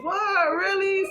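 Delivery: high-pitched incredulous questions; mock disbelief. Daughter hearing the girl is coming, feigned surprise. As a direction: play innocent, voice jumping up high.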